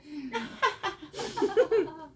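high, far-off witch cackle. Pretend. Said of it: "That was the perfect witch, witch cackle".

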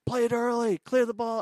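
high-pitched, loud sideline shout, imitated; imitated coach's urgent call; mock coach yell. Acting out over-controlling coaches.

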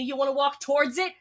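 loud, high in-character protest; heated, incredulous objection; alarmed protest. Acted. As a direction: burst out in disbelief, voice rising. In-character protest against approaching monster.

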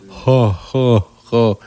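imitated slowed-down laughter, spaced out; heavy, separate mock laughs in slow motion. Audience laughing at a bombed comic, slow-motion mock laugh. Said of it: "it was, like, slow motion".

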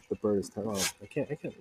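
ordinary talk

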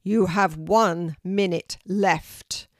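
loud and slow, a giant tree counting down; slow, heavy warning from the old tree; booming oak tree. A narrator's reading. Oak tree warns Kelty.

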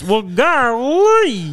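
high, slow hayseed drawl; Gomer Pyle. Retold or imitated. Friend imitates the fifties sitcom character, high goofy drawl. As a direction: draw it out like a country simpleton. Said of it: "Gomer Pyle".

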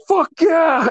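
high, pumped-up retold cheer; imitated triumph. Cyclist after pedaling fast, high imitated triumph. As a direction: cheer it high, as your buddy did.

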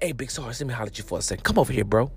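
hushed secretive whisper. Retold or imitated. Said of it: "Why we whispering?".

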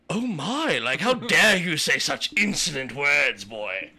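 hammed-up scandalized stranger scolding him; prissy scandalized onlooker. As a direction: play a pearl-clutching bystander.

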